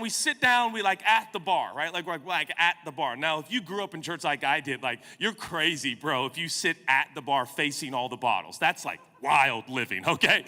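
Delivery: ordinary talk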